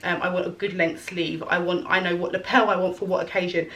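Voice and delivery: ordinary talk, nothing marked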